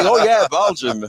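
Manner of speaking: high mock "Oh yeah, Belgium"; mocking everybody's reaction. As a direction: play the people who shrug at Belgium.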